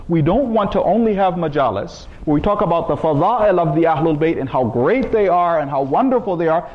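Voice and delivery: ordinary delivery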